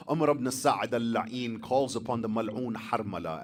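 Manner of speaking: usual speaking voice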